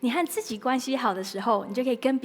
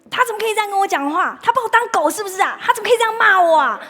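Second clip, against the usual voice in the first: much higher and louder, indignant rant; Mr Anger's outburst; 10 semitones higher, 12 dB louder, faster than usual. Acted. Mr Anger venting about being insulted, indignant rant. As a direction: voice an offended man venting. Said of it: "那愤怒先生就很生气，就说".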